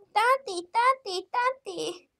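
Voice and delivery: put-on high, young child's voice calling auntie; little child asking auntie. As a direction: play a small whiny kid.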